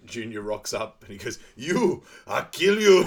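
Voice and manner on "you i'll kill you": loud, high, drawn-out movie-quote yell; hammed-up furious threat, played for laughs; mock angry threat. Junior confronted, acted threat, chase.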